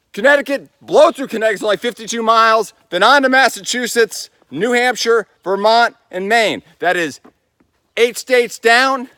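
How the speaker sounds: rally through the list; excited. Real, not acted. Excited list of states ahead.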